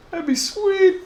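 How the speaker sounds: very high, laughing, slowed; laughing falsetto delight; squealing laugh. Real, not acted. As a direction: giggle it out in a squeak. Laughing at own joke.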